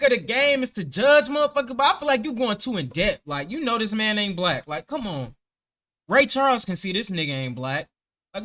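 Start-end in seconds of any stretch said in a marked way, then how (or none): none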